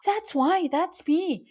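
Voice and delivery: high, amazed realization, pitch leaping up; sudden realization. Retold or imitated. Host recalling the moment she recognized herself, astonished. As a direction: gasp then light up with recognition. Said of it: "and I was like".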